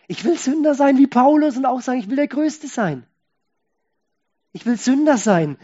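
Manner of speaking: loud, high, impassioned preaching; fervent preaching. Acted. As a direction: pour it out like a confession of joy. Climactic preaching, prayer quotes. (leidenschaftlich predigend)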